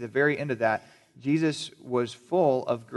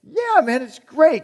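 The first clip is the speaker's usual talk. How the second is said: much higher, louder mock enthusiasm; overeager praise; 12 semitones higher, 6 dB louder, slower than usual. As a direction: gush falsely over a haircut. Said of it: "they're going to answer you and say".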